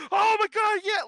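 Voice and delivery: high, loud burst of excitement; imitated outburst. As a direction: explode in mock fanboy joy. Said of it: "goes from intense to just like".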